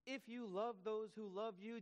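impassioned preacher restating Jesus; emphatic paraphrase. Shown as a demonstration. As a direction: drive the words home to the congregation. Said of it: "Jesus says".

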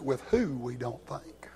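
whispered, soft sermon ending; closing whisper. Acted. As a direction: let the last line fall to a whisper.

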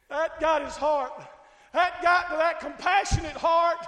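loud, high pleading sermon cry; preaching at full force. Acted. Preacher voicing the woman begging the Lord, loud pleading preaching.

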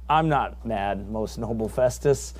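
a humble, measured defence; Paul's calm reply. Retold or imitated. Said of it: "Paul answering".

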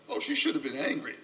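ordinary talk

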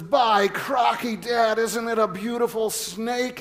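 boy thrilled by a copperhead, in a mock Australian accent; excited Irwin impression. Son showing off a copperhead, in a loud mock Aussie voice.

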